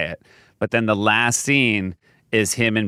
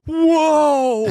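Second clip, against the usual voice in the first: high loud amazed whoa; excited whoa; 4 dB louder than usual. Retold or imitated. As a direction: marvel at it. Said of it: "in unison go".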